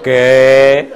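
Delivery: loud, drawn-out incredulous "what?"; astonished. Retold or imitated. Preacher retelling his reply to an atheist caller, astonished. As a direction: act out a stunned, loud reaction.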